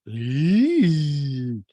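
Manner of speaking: long, loud high "eee" squeal; Rinna. Retold or imitated. Recapper imitating a castmate's reaction to honesty, drawn-out squeal. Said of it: "Rinna looks at Eric and goes".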